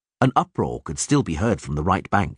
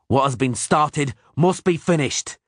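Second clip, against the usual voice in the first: snapped; 6 semitones higher than usual. Acted. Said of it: "Geralt snapped".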